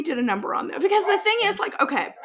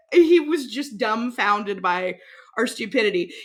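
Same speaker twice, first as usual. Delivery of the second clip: usual speaking voice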